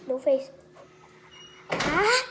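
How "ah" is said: high loud yelp from the heat; pained cry. Real, not acted. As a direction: cry out at the burn. Eating burning spicy noodles.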